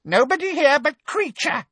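very high, gleeful elf voice; gleeful house-elf. A narrator's reading. Elf telling the boy he is alone, gleeful. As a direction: gloat in a high creature voice. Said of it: "said the elf gleefully".

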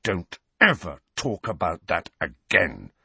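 deliberate, menacing snarl; controlled fury. A narrator's reading. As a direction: snarl it as a threat, word by word. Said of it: "Harry snarled".

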